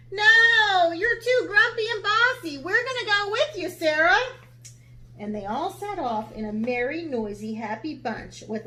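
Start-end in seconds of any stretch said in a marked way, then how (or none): 0.1-4.2 high, loud and protective; animals. Acted. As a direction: refuse the lion cheekily.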